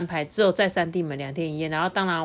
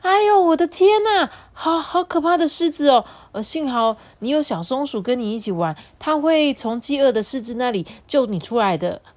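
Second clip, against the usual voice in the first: higher, louder hammed-up alarm; mock-frightened mother; 8 semitones higher, 5 dB louder than usual. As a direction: fake fright to play along. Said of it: "就说".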